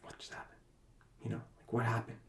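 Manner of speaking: half-whispered and breathless after panic; hushed and stunned after an anxiety attack; shaken, hushed. Retold or imitated. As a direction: barely get the words out, still rattled. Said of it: "I'm, like, hyperventilating".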